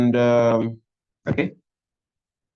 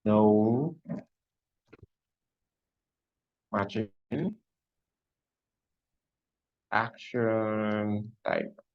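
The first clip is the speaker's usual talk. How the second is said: slow dictation while typing; 4 dB quieter, much slower than usual. Shown as a demonstration. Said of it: "we are just going to say".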